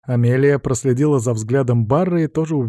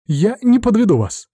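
eager, earnest promise; passionate assurance; 10 semitones higher, 4 dB louder than usual. A narrator's reading. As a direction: assure him with fervour. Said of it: "страстно заверила графа Амелия". (страстно, горячо)

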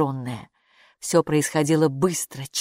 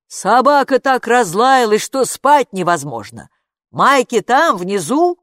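calling father; 6 semitones higher, 8 dB louder than usual. A narrator's reading.

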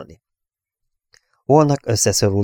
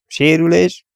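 high, louder one-word query; raised captain's question; 10 semitones higher, 5 dB louder, slower than usual. A narrator's reading. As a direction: ask for a damage report, pitched well up. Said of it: "kérdezte Dennis".

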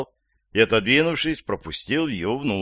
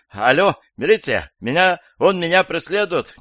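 high, raised cry for help; panicked; 8 semitones higher, 5 dB louder than usual. A narrator's reading. Old woman phones the police about a pursuer, panicked. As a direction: call out high and urgent, in a panic.

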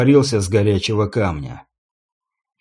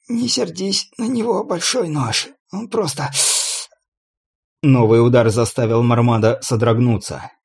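lightly mock the torturer; young thief voice; 7 semitones higher than usual. Captive mocks calmly, other voice. (насмешливый голос пленника)

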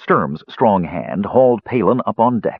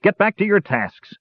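high-pitched, exasperated orders; irritable roar; 9 semitones higher than usual. A narrator's reading. Dwarf captain shooing his creatures to work, irritable roar. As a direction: shoo them off crossly. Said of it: "Dugan roared irritably".

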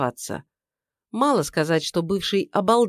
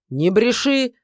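angry shout; 6 semitones higher, 5 dB louder, slower than usual. Acted. Shout with table slap.

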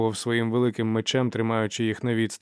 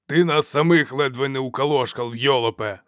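moderately raised scolding voice; angry shout; 3 semitones higher, 4 dB louder than usual. A narrator's reading. Chief berates Dow for nearly killing them, shouting. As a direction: tell him off, raising your voice. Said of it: "закричав Тридуба".

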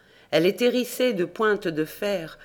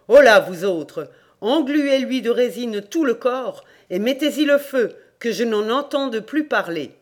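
loud, raised order to henchmen; barked command; 5 semitones higher, 6 dB louder than usual. A narrator's reading. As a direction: call out sharply, then keep the voice high. Devil orders his henchmen.

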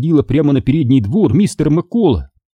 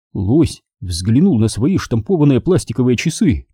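usual speaking voice

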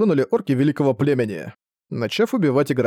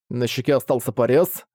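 usual speaking voice

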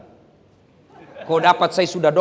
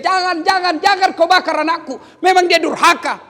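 high, loud desperate plea; mother's shout; 13 semitones higher, 7 dB louder than usual. Acted. Mother begging them not to burn her son, shouting. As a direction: cry out to stop them at once. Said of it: "ibunya berteriak".

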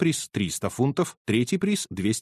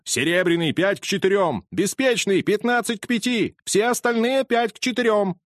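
crowd calling odds; 9 semitones higher than usual. Acted. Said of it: "неслось с трибун".

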